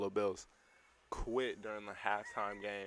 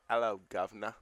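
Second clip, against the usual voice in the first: louder stock Cockney British greeting; British accent; 5 dB louder than usual. Shown as a demonstration. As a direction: put on a cheesy British accent. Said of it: "British accent, everyone can do".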